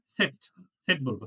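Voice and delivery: সেট said as tset, not zed. Shown as a demonstration. Teacher corrects the class: Z is সেট, not zed. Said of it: "আমরা জেড বলবো না".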